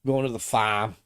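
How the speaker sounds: mock Boston, "farm" with no R; playful Boston voice; light Boston imitation, r dropped; Boston accent. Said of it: "Their Boston accent is weird".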